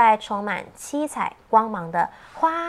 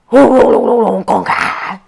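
loud imitated thunder boom; thunder rumble and crack; 10 dB louder than usual.

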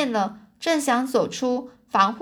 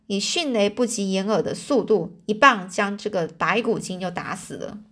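usual speaking voice

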